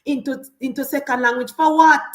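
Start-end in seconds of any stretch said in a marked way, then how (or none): 1.5-2.0 loud, higher scornful retort; loud scornful challenge; indignant burst. Real, not acted. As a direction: spit out the question in disbelief. Scoffing at Patois plan.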